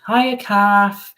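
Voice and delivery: high, loud catchphrase greeting; comedy catchphrase. Retold or imitated. Greeting a viewer with a comedy's catchphrase, mock sitcom voice. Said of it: "does anybody know that program, that comedy".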